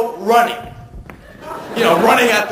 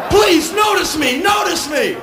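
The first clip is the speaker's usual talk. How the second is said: high pleading shout, half laughing; desperate plea; 11 semitones higher than usual. Retold or imitated. Soaked traveller begging passing cabs, high pleading shout. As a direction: yell it like you're drowning.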